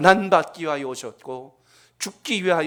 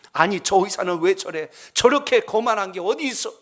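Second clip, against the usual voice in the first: rising, louder grumble of outrage; father fuming as the doctor walks off; indignant shout; 5 semitones higher, 3 dB louder than usual. Retold or imitated. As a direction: complain loudly at the rude doctor. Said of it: "하고 또 소리를 지릅니다".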